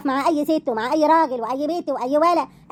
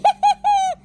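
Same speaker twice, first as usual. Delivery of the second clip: high mocking burst of laughter; scornful high laugh; 4 dB louder than usual. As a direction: laugh in his face at the very idea. Wife laughs at buying women.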